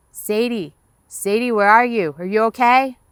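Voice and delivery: shouting her name across the water; worried calling. Acted. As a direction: call out, searching in the dark. Dad calling from upriver.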